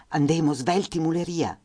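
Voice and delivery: put-on high, urgent call to the children in Fiume dialect; mother urging her children on in Fiume dialect. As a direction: hurry the kids along in Fiume dialect. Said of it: "si rivolse a noi in dialetto fiumano".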